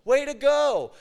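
high, loud acted cheer; encouraging cheer. God praises the preacher after his failed attempt, encouraging cheer. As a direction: cheer them on like a delighted coach. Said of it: "he's just encouraging me".

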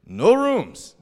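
high, loud blunt refusal; mock insistent clerk voice; imitated hotel clerk. Retold hotel clerk refuses them.

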